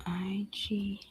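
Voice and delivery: breathy whisper of the letters I G. Real, not acted. Streamer spelling a town to herself, whispered. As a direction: spell it under your breath to yourself.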